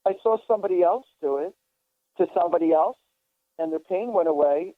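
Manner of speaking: usual speaking voice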